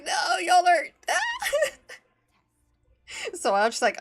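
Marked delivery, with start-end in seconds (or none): 0.0-3.4 high, loud mock-wail breaking into laughter; exasperated outcry. Recounting a failed watching attempt, exasperated high outcry. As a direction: cry out in overblown protest, then crack up. Retelling own exasperated reaction.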